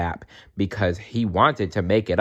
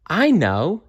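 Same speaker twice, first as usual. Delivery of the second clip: high, bright realisation; excited elf; 10 semitones higher, 3 dB louder than usual. Acted. Elf realises where the egg fell from, excited and high. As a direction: pitch it up with a sudden idea. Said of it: "she said".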